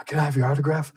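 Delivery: put-on a small boy asking a star; little kid asking Bo for his autograph, timid; young me asking.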